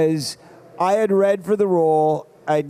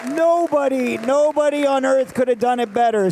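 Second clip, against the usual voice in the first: usual speaking voice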